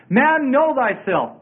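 slow, high, drawn-out motto; intoned ancient maxim; proclaimed maxim. Acted. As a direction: stretch it out like a grand pronouncement. Repeating the Greek maxim.

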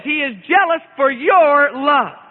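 slow, "your" pressed down; weight on "your" in "He is jealous for your love"; stress on YOUR. Shown as a demonstration. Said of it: "emphasize the word your".

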